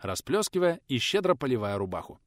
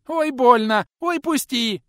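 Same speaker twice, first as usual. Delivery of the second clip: high shrill cry of pain; piercing squeal; 13 semitones higher, 10 dB louder, slower than usual. A narrator's reading. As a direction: squeal out in panic. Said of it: "пронзительно верещал малой". (пронзительный визг)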